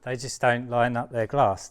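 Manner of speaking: loud, strained under weight; straining. Real, not acted. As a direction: force it out mid-lift. Straining with the pane.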